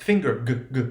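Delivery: FINGER with the hard g sounded, then the g alone; voiced velar plosive g in FINGER, repeated alone; audible hard g. Shown as a demonstration. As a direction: let the guttural g pop in FINGER, then isolate it. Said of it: "You can hear that guttural sound".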